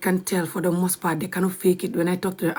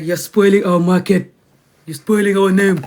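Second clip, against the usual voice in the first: mocking angry haters; 8 dB louder than usual. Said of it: "They're like".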